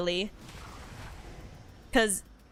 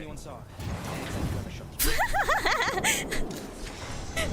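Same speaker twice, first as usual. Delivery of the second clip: long high laugh; 12 semitones higher, 3 dB quieter than usual. Real, not acted. Laughs at own retreat.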